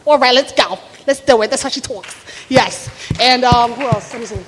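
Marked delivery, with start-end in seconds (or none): 0.0-2.9 rounded New Jersey "tawk" vowels; Dolores. Acted. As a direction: brag about the doctor son in thick New Jersey. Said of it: "who talks like this".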